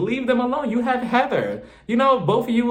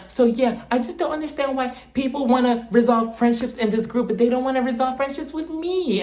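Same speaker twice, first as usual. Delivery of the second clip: mock Whitney; 6 semitones higher than usual. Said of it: "Whitney's just like".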